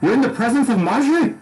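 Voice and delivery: high, bright Scottish god voice; a jolly god speaking in Scottish; Scottish accent. Acted. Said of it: "a little Scottish".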